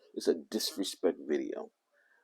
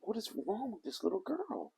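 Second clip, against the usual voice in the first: bewildered; 6 dB quieter than usual. Retold or imitated. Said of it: "And I'm like".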